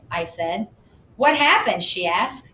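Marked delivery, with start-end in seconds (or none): 1.2-1.8 put-on high curious girl's voice. A narrator's reading. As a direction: ask it high like a young girl. Susie voiced.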